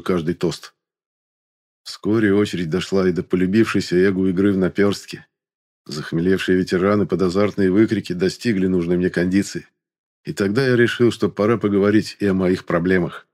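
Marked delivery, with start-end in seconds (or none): none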